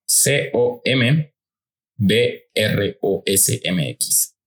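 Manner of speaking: very slow, letter by letter; drawn-out letters, each one separate; spelling out. Real, not acted. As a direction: spell it out slowly for listeners. Spelling the handle for listeners.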